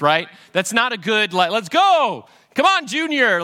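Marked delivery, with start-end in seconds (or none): 1.5-3.4 mock call to leave. Mock fleeing with family, calling out.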